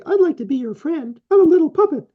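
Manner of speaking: mock a cartoon puppet to show how insincere it sounds; silly puppet voice. Therapist mocking a fake friendly approach in a puppet voice. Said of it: "You can't say".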